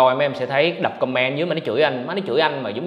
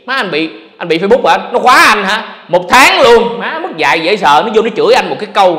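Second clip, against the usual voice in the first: louder, rising outrage; real exasperated outburst, getting louder; indignant rant; 5 semitones higher, 7 dB louder than usual. Real, not acted. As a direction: vent about the unfair ban. Ranting about Facebook ban.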